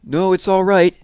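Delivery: high, loud call from under the sheet. Acted. Barnaby calling to Little Bear from under the sheet, loud and high. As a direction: call out to calm him. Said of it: "called the ghost".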